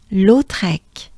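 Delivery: "Lautrec" whispered with a throaty French R. Shown as a demonstration. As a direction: breathe "Lautrec" out without voice. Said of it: "So it's".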